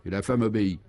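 ordinary delivery

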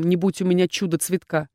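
plain reading voice

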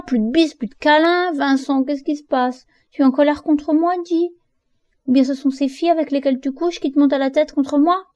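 high, upset shouting at her brother; crier, blessée; crying out. A narrator's reading. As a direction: cry out in hurt anger. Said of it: "je me suis mise à crier".

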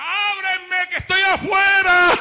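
loud, high, slow shout from outside; Christ calling out. Acted. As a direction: call through the closed door, loud.

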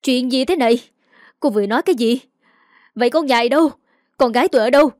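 a mother's alarmed, angry outburst; shouting. A narrator's reading. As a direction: yell questions in shock. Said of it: "bà Kha hét lên".

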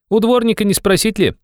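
high-pitched youthful suggestion; young man. A narrator's reading. Young man's dialogue voice. (высокий молодой голос)

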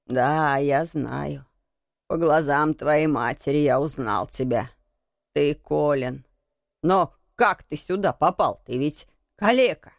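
put-on low, mildly shaken old man; lightly voiced, stunned old man; trembling old man. A narrator's reading. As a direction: answer low, with a touch of trembling. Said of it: "странным дрожащим голосом".

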